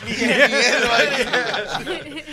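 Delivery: slow, loud words through laughter; cracking up while getting it out; laughing hard. Real, not acted. Laughing at who will attend.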